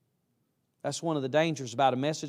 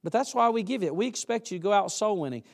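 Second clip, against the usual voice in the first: ordinary delivery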